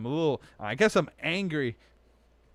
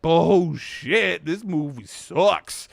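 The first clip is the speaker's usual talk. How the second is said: loud, slow scoff; loud dismissive; 4 semitones higher, 8 dB louder, much slower than usual. Pretend. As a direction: bark it out dismissively. Imitating own loud dismissal.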